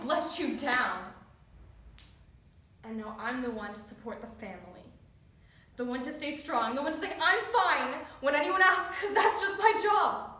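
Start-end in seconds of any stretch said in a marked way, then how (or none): 5.8-10.3 building louder and higher to breaking point; frustrated outburst. Acted. Child having to support the family, frustrated outburst. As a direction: let the burden build into loud protest.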